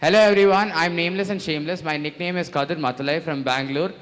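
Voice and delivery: ordinary delivery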